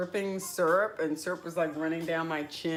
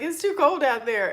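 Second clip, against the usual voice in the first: higher and louder, a whiny mock protest; mock cold complaint; 10 semitones higher, 8 dB louder than usual. Herself picturing a winter up north, complaining much higher and louder. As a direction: whine about the cold like a reluctant kid. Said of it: "I would be like".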